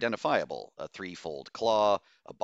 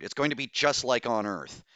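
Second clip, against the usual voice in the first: louder, higher protest; exclaiming; 5 semitones higher, 5 dB louder than usual. A narrator's reading. Doctor fears history repeating, heated exclamation. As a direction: exclaim it in dismay. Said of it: "Bose exclaimed".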